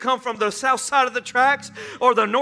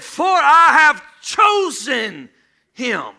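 proclaiming; 5 semitones higher, 9 dB louder, much slower than usual. Acted. God's words proclaimed.

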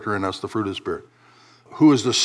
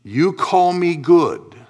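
very slow, raised pitch, drawn-out words; said slowly; 6 semitones higher, 5 dB louder, much slower than usual. Shown as a demonstration. Jesus spelling it out for the young man, very slowly. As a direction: stretch every word so it sinks in. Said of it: "I'll say it slowly".

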